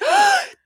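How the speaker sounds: sharp, high gasp; big startled intake of breath. Retold or imitated. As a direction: gasp in mock horror. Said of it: "I was like".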